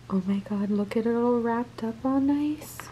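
hushed excited whisper. Real, not acted. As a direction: whisper before the reveal. Hushed anticipation.

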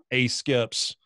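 normal talking voice

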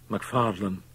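slightly hoarse, hesitant address; a touch of strain in the voice; somewhat hoarse. A narrator's reading. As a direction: begin a little hoarsely. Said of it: "still somewhat hoarsely".

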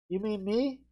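normal talking voice

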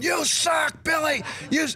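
very high, jeering yell; crowd heckle. Retold or imitated. Extras turning on the pitcher under a pop-up, high jeering shout. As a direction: imitate angry fans screaming from the stands.

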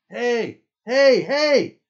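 very high, loud cries of protest; disbelief. A narrator's reading. Camper being punched and kicked, disbelieving yell. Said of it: "Rote's in disbelief and shows it in the way he says".

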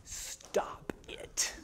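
whispered sharp stop it; silent yell. Retold or imitated. Wife hissing at husband from the sidelines, whispered yell. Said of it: "silently yells".

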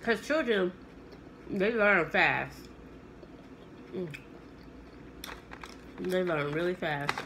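eating between words; talking while chewing. Real, not acted. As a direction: mouth partly full of food. Seen 1: bites roll at -3; lips pursed, chewing, roll in hand throughout.

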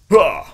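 sharp effort grunt; short strained huh. Real, not acted. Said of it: "Why are you making Roddy sounds".

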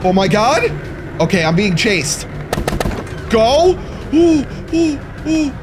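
flee in terror, squealing; panicked screaming. Real, not acted. Fleeing a chaser in the game.

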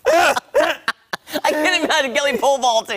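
high, slow laughing disbelief; laughing tease. Real, not acted. Friend teasing Kelly about pole vaulting, laughing high. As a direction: giggle it out, picturing it.

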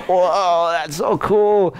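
mock-awed jumper gazing down at the beach. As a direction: savor the view from the cliff top. Jumper admiring the view below.

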